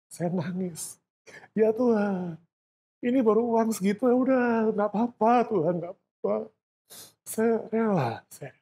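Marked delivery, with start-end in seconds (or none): 1.5-8.6 high, strained voice praying through tears; tearful prayer. Retold or imitated. Preacher reliving his tearful prayer of surrender. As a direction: plead with God through tears.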